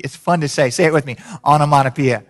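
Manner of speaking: normal talking voice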